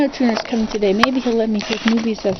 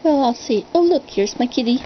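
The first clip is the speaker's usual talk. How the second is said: normal talking voice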